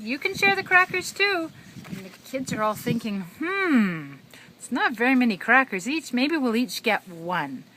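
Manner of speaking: high, loud singsong storytelling; broad singsong kids theatre. A narrator's reading. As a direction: ham it up for a toddler. Reading to a toddler.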